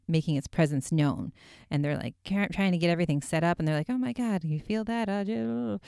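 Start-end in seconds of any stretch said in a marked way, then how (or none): 3.9-5.8 startled and spooked. Retold or imitated. Retold ghost-hunter startled quote.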